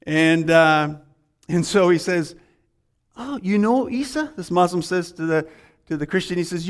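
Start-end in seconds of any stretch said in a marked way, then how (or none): 3.2-4.3 pitched-up, lightly voiced amazed question; surprised question. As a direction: sound caught off guard by the name.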